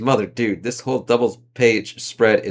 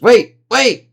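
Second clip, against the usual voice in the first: panicked shout; 12 semitones higher, 8 dB louder than usual. Acted. Character panics mid-fall.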